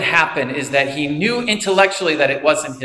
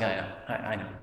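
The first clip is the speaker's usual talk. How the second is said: much softer, lower, dismissive mumble; quiet brush-off; 7 semitones lower, 15 dB quieter, faster than usual. Retold or imitated. Will brushing off Sean's words, soft and low. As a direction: shrug it off quietly.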